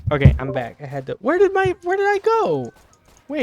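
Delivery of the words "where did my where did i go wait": high loud flustered panic; panicked confusion. Real, not acted. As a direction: blurt it out, lost and panicking. Returns, can't find character.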